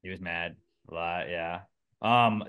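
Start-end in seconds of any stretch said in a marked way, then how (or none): none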